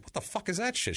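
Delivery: shocked high squeal of surprise; high startled yelp. Retold or imitated. Attacker bitten by the hidden dog, squealing in high surprise. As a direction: jump at the bite, voice shooting up. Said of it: "So he's like".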